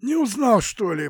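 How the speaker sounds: high, loud hoarse rasp; hoarse wheeze. Acted. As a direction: wheeze it through a sore throat. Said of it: "просипела в ответ".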